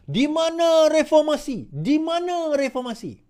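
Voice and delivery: high, slow mock yell; mock shouting protester. NGOs shout at Anwar Ibrahim, slow mocking yell. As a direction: howl it like a crowd of complaining NGOs. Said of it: "memekik melolong".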